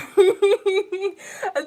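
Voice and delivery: loud, high burst of giggling; high giggle. Real, not acted. Reader apologising to pile two, giggling helplessly.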